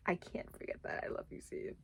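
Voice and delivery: soft, hushed whisper. Real, not acted. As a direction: trail off into a whisper. Lingering over a touching scene.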